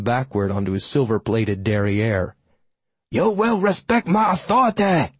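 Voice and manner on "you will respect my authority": high, squawking cartoon demand; hammed-up bossy cartoon kid; Eric Cartman imitation. A narrator's reading. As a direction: demand respect in a much higher cartoon voice. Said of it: "in a passable imitation of Eric Cartman".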